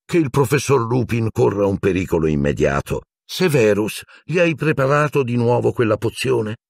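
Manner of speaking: a touch of cheer, steady and decided; lightly cheerful, moving things along; cheerful but firm. A narrator's reading. Dumbledore asking Snape about the potion, lightly cheerful. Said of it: "con voce allegra ma decisa".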